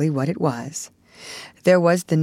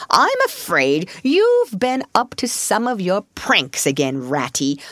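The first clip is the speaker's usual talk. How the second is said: paternal; 2 semitones higher than usual. A narrator's reading. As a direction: chide a mischievous youngster fondly. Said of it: "he said paternally".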